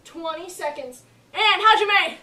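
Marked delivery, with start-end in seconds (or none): 1.3-2.2 shouted command. Real, not acted. Instructor calls start command.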